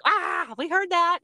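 high, drawn-out mock scolding of kids; kids catching mom. Mother mimics her girls catching her cussing, high-pitched teasing. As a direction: imitate children gleefully catching a slip. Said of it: "and they're like".

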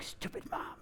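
whispered imitation of a muttering child; barely audible mock mutter; muttered under the breath. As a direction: whisper it under your breath as you walk off. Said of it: "you could just hear her".

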